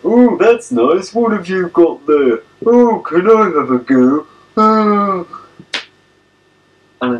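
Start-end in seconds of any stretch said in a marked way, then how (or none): 0.1-5.2 loud, high, drawn-out busybody voice; mocking high-pitched nosy-neighbour impression; nosy old neighbour. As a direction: coo nosily over the fence, hammed up. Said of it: "the nosy old so-and-so over the road will go".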